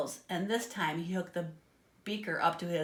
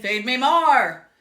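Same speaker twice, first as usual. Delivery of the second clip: very loud, very slow monster pig bellow; giant pig shout; 5 semitones higher, 13 dB louder, slower than usual. A narrator's reading. Giant pig demanding food, slow roaring bellow. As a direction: bellow your hunger like a giant beast. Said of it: "he shouted".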